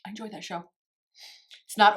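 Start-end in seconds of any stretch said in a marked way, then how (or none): 0.0-0.6 softer, much quieter, a touch of teasing; wife answers her husband softly, playing along; soft reply. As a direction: quietly play along with the joke. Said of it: "I'm like".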